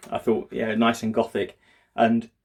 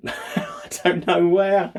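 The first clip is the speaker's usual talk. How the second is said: laughing; 7 semitones higher, 4 dB louder, much slower than usual. Real, not acted. Laughing at own missing shoes.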